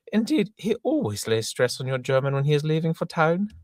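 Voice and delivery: very high, loud, prim governess voice; Miss Prism. Acted. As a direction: scold her pupil in a shrill falsetto. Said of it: "Miss Prism".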